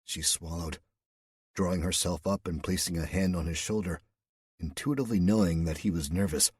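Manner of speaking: plain reading voice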